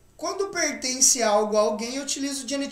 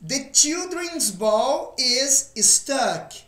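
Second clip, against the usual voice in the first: slow, careful English model sentence; teacher reads it slowly for the class; slow model reading; 2 semitones higher, much slower than usual. Shown as a demonstration. Teacher models the sentence slowly for students. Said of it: "Próximo exemplo".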